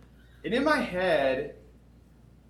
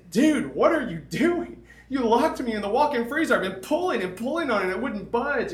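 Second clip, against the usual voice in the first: exasperated outburst; 8 semitones higher, 5 dB louder than usual. Retold or imitated. Confronting the guy who locked him.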